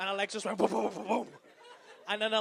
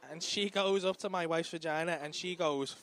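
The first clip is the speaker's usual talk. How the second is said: ordinary talk, nothing marked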